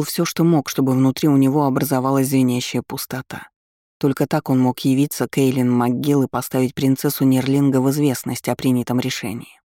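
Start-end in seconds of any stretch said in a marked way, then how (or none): none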